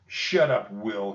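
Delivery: usual speaking voice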